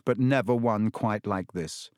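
usual speaking voice

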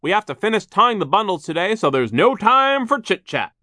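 high, loud bossy foreman; shouting. A narrator's reading. Foreman forbids chatting on the job, shouting. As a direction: keep hollering orders at the workers. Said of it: "he shouted".

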